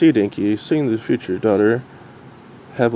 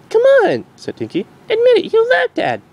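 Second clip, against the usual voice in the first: very high, louder wheedling; teasing filly; 4 dB louder than usual. Acted. Dinky pressing her mom to admit it. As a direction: egg her on playfully.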